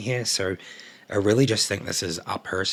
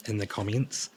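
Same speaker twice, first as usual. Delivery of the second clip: ordinary delivery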